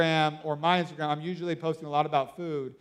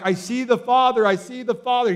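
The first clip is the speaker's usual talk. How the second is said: mild mock scream, raised pitch and louder; imitating a man crying out in awe; retold scream; 6 semitones higher, 7 dB louder than usual. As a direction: cry it out as an overwhelmed man, held back from a full scream. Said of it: "He's just screaming".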